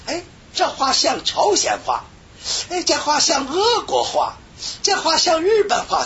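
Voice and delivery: high-pitched mock self-admiration; self-admiring. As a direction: marvel at your own babble, pitched high. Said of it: "也自个儿还在欣赏". (高声模仿自我欣赏)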